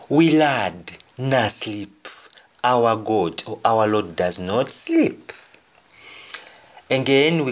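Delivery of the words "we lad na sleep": slow, drawn-out patois line; slow Jamaican patois; slow patois gloss. Shown as a demonstration. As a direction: spell it out slowly in patois.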